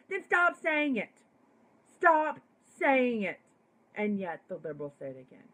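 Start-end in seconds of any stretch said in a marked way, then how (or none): 0.1-1.1 emphatic. Real, not acted. Repeats stop saying it forcefully. 2.0-3.4 louder insistent repeat. Real, not acted. Telling liberals who use the word to stop, loud and insistent.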